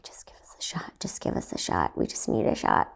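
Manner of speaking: hushed pleading whisper. Retold or imitated. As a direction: whisper it so the doctor can't hear. Mom and I at the doctor's.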